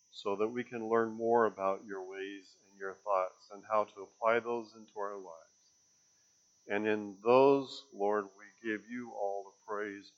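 hushed, earnest prayer voice; quiet preacher's prayer. Acted. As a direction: pray humbly to the Lord. Leads the congregation in prayer.

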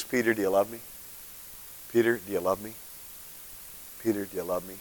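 soft, low, gentle asking; gentle question. Retold or imitated. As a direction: ask him tenderly. Jesus asks gently, thrice.

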